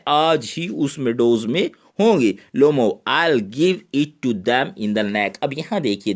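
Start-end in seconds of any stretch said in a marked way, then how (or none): 3.1-5.4 slow read. Shown as a demonstration. Teacher reads Lomov's threat slowly.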